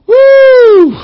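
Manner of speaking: high, loud whoop of release; a sudden Spirit-filled whoop; joyful whoop. Real, not acted. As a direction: let out a real shout of joy. Overcome mid-sermon.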